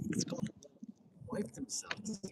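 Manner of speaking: very soft, breathy imitation of baffled muttering; hushed retold disbelief. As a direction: whisper it, barely voiced. Retelling host's baffled muttered reaction.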